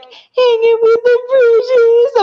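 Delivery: mock her squeaky line to tease her; teasing high voice. Husband teasing wife by copying her squeaky phone line. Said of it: "you still to this day will be like".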